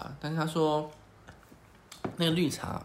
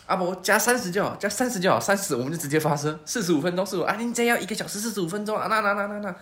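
higher, louder, rushed bargaining; panicked haggling; 6 semitones higher, 5 dB louder than usual. Retold or imitated. Flustered tout cutting the price to keep them, high and loud. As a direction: desperately drop the price. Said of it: "阿伯更慌了".

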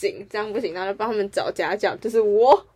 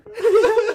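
short high laugh; 13 semitones higher, 7 dB louder than usual. Real, not acted. Laughing at kid reading manga.

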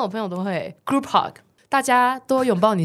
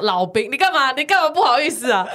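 usual speaking voice

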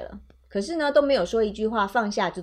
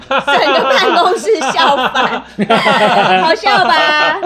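laughing; 4 semitones higher, 9 dB louder, much slower than usual. Real, not acted. Laughing at her own punchline.

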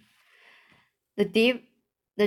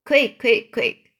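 louder burst of command; urgent; 2 semitones higher, 6 dB louder than usual. A narrator's reading. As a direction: call it out urgently, in a quick volley. Urgent call for another jar.

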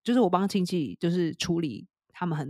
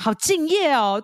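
mock awe; 7 semitones higher, 5 dB louder, slower than usual. Teasing Santa's dedication.

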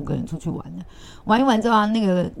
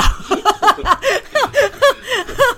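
high, loud helpless laugh; burst of laughter; 7 dB louder than usual. Real, not acted. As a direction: crack up at the memory. Laughing at own story.

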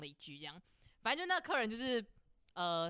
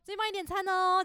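much higher, sugary service voice; fake politeness; 10 semitones higher than usual. The cashier pretends nothing happened, sugary polite. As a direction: chirp it sweetly as if nothing happened. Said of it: "我就装没事的继续".

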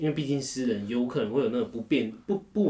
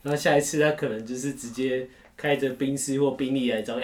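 ordinary talk, nothing marked

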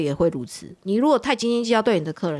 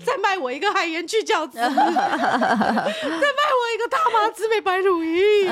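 much higher, slow, whiny pleading, laughing; clingy begging fans; 13 semitones higher, slower than usual. Pretend. Fans clinging to the host during a livestream, slow high whiny plea. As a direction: beg her not to go, dragging every word. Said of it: "扒着我大腿".

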